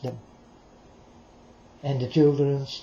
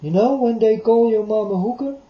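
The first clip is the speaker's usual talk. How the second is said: higher and louder on "call"; raised voice; 6 semitones higher, 4 dB louder than usual. Real, not acted. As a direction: lift it as a point. Repeats the insult point, raised.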